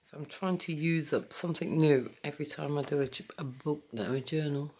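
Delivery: ordinary talk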